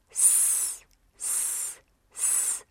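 drawn-out voiceless "S-", very soft; "S-" as a long hiss with no vowel; phonics S sound. Shown as a demonstration. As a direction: hiss "S-" on its own, repeated. Teacher sounds out the first letter.

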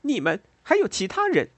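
high, loud angry accusation; angry outburst. A narrator's reading. Woman lashing out at two silent men, angry outburst. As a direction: burst out at them, pitch leaping up. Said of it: "让莉拉更加气愤".

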